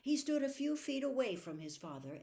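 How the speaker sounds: ordinary delivery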